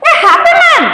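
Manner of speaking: high surprised exclamation; excited cry. A narrator's reading. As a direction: cry it out in amazement like a small boy. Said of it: "cried the little stable boy".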